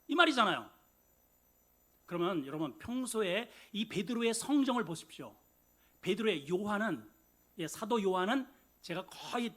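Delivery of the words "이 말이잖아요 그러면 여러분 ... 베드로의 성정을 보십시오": usual speaking voice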